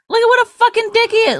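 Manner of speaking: high, fast mocking voice; mock outraged quote. Wife mocking husband, high and fast. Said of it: "It's like".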